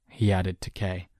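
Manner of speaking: plain reading voice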